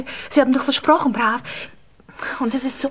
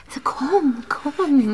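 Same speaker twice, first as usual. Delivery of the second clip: tonlos lockend; flüstert ihn herbei; geflüstert; much slower than usual. Acted. Geliebte ruft ihn zu sich. (lockendes Flüstern)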